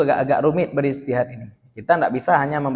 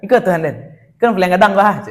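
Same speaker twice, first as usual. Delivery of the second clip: louder, jeering Minang aside; Minang-style mockery; 5 semitones higher, 5 dB louder than usual. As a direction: jeer lightly, on the edge of laughter.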